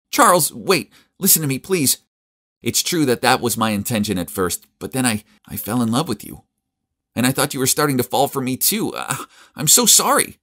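pleading. A narrator's reading. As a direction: beg him to listen, voice up high. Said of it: "she interrupted me and said".

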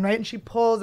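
normal talking voice

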